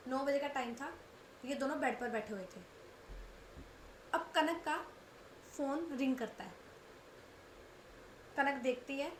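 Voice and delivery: usual speaking voice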